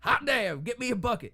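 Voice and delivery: high, loud hammed-up Irish yell; excited Irish townsman. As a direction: shout it like a giddy local. Retold Irish townsfolk shouting.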